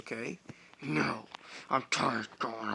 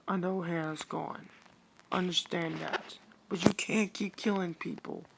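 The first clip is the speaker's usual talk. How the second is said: higher-pitched firm plea; Alexis voice; 6 semitones higher than usual. Acted. Alexis urging CJ to stop killing, higher voice. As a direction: reason with him urgently.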